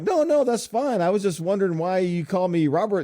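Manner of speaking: high, bemused reassurance; calm the AI down while still confused; puzzled, placating reply to the chatbot; surprised reassurance. Retold or imitated.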